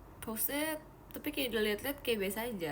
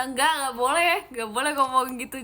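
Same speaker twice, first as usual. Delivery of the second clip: louder playful telling-off; mock scolding; 3 semitones higher, 8 dB louder than usual. Real, not acted. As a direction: scold the chat in fun. Scolding a viewer's teasing. (menegur dengan bercanda)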